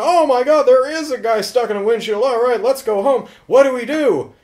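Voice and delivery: high, loud mock panic; alarmed cry, pitch way up; panicked mom. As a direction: act out a frantic mother. Acts out panicking mother.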